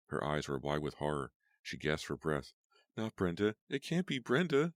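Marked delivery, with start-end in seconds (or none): 3.0-4.7 high, loud disbelieving cry; rising panic, refusing it. A narrator's reading. Tracy crying out in disbelief over Brenda, high and loud. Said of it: "she cried".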